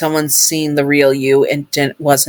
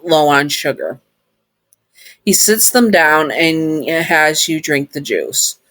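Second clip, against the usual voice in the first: ordinary delivery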